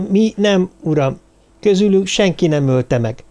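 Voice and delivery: high, hesitant little-woman voice; polite little lady. A narrator's reading. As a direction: speak up shyly in a small high voice. (udvarias, vékony hangú kis hölgy)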